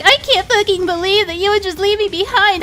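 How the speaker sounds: high, whiny Connie parody; mock her hurt tone. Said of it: "And she's like".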